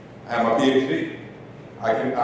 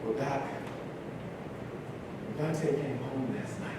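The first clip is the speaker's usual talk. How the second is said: much softer, hesitant student voice; apprehensive hush; 4 semitones lower, 16 dB quieter than usual. Retold or imitated. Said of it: "she kind of was a little bit apprehensive".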